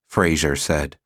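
ordinary delivery, nothing marked

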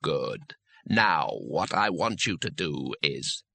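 a hollow voice from below, spelling it out slowly; patient disembodied voice. Acted.

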